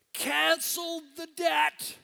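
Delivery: high, loud and slow, shouted with joy; a preacher's triumphant cry; exultant shout. Real, not acted. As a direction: proclaim it like good news bursting out. Preacher exults over cancelled debt.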